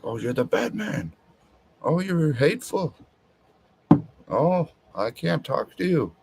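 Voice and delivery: high-pitched mocking imitation of a woman; mock woman's voice. Man imitating wives complaining to their husbands, mock woman's voice. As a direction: put on a high, whiny female voice. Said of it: "I don't know why I'm using that voice for women".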